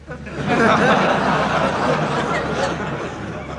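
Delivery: short, awkward laugh with a rising end; a flustered giggle; nervous laugh. Acted. Laughs at a joke.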